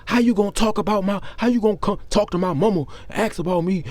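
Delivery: high, loud indignant mimicry. Cousin seeking attention outside church, imitated loud indignation. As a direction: raise pitch and volume for the aggrieved cousin. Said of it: "He gets louder".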